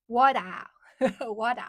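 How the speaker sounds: "Water" with a long open ending, no R; loud "Water", R dropped; British-style water. Shown as a demonstration. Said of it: "Water is water".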